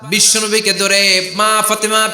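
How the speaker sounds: high, sustained sermon chant; impassioned preaching. Acted. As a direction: carry the tale on a high ringing note.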